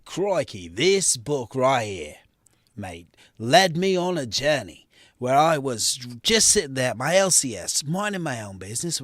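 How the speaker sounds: hammed-up Aussie storyteller; mock Australian bush-tale voice; fake Australian accent. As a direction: tell a yarn in a put-on Australian accent. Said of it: "Speaking of crazy fake Australian accents".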